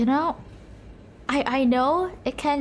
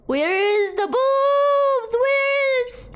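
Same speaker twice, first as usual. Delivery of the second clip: frantic yelling; 6 semitones higher, much slower than usual. Real, not acted. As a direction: yell at them in a panic to find it.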